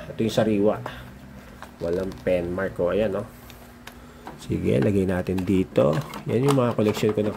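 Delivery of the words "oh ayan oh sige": ordinary talk, nothing marked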